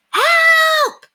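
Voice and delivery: high, loud desperate shout; loud high shout for help; yell. A narrator's reading. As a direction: bang on the door and yell. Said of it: "he yelled".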